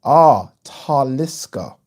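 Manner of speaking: "Taliska" with the weight on TAL, drawn out slowly; "Taliska" slow, first syllable stressed. Shown as a demonstration. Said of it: "emphasis on the first syllable".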